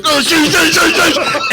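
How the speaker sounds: high, rushed panicked swearing with a laugh; mock panic as the trooper pulls up; panicked cursing. Driver panicking as state trooper pulls in behind. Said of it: "I'm like".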